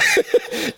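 a sudden high real laugh; burst of laughter. Real, not acted. Recalls dying laughing in truck.

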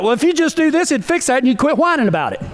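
a mock blunt husband; blunt guy. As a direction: brush off the complaint with a quick fix. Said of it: "Us guys will be like".

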